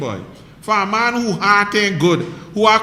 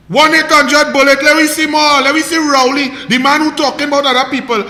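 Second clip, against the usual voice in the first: loud rant; 5 semitones higher, 6 dB louder, faster than usual. Real, not acted. Ranting at Rowley.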